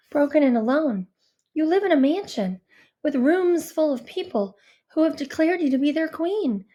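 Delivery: ordinary delivery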